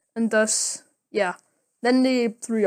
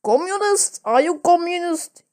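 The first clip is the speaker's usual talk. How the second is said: mock Russian accent, much higher and a bit louder; mock Russian officer; 7 semitones higher, 3 dB louder than usual. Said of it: "And then he says".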